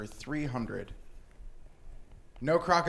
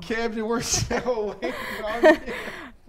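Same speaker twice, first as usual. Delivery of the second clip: slow, loud and high through laughter; laughing; 7 semitones higher, 5 dB louder, slower than usual. Real, not acted. Game show host laughing at a stuck contestant, amused. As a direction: crack up while chivvying someone along.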